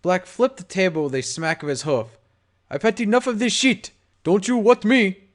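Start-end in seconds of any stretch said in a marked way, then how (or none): 2.7-5.3 angry yell. A narrator's reading. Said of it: "he yelled".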